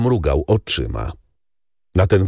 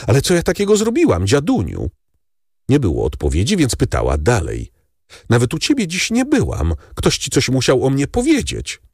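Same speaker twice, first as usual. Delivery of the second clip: lightly voiced young woman, high and fast; a girl anxiously begging her grandfather; worried girl's voice, hurried and higher; pleading granddaughter; 7 semitones higher than usual. A narrator's reading.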